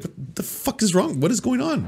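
high, hushed mock bewilderment; baffled disbelief. Baffled man in a laughing audience, hushed mock disbelief. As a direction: play it lost and incredulous, under the breath.

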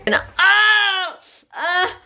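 high startled cry; reenacted fall. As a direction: act out slipping. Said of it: "That's how I was doing it".

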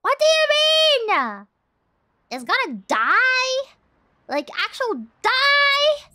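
high, loud, stunned cries of disbelief, slowing down; can't believe he's really going to die; shocked disbelief. Real, not acted. Shocked the character will die.

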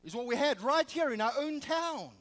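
ordinary talk

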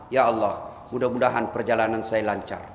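lightly voiced pupil praying; mock student prayer. Student praying for a smooth trip, softly mocking.

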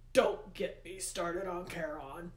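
slow, restrained yell; Hades yelling. A narrator's reading. Hades reacts to talk of Charon's pay, yelling. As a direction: cut the subject off with slow, forceful irritation. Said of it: "Hades yelled".

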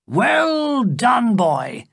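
loud, high cry of praise; cried out. A narrator's reading. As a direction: call it out with gusto. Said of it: "cried Sapt".

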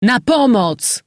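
loud, high, drawn-out cry for help; terrible cry. A narrator's reading. General rousing the house for vengeance, crying out. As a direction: stretch each call out at a shout. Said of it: "Wydał straszliwy krzyk".

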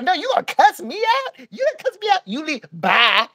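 high mock scolding, cut off by curt byes; angry yelling woman. Clerk kicking the guest out today, mock angry yelling. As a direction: imitate her high-pitched dismissal of the guy.

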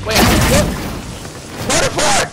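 sudden high shout; surprised yell. Real, not acted. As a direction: yelp it out in surprise.

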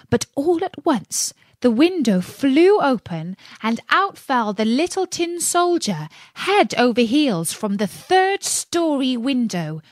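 plain reading voice

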